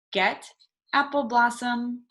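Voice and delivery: ordinary talk, nothing marked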